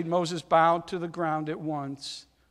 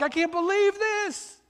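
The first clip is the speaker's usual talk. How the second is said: much higher, awestruck disbelief; amazed angels; 11 semitones higher than usual. Retold or imitated. Angels marvelling at God's love, high and amazed. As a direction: gasp it out like astonished angels. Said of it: "The angels are like".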